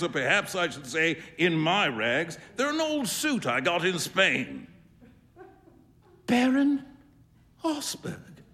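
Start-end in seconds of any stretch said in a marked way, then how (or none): 6.3-8.4 high, stunned gasp of a name; shocked cry. A narrator's reading. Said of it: "cried Huey".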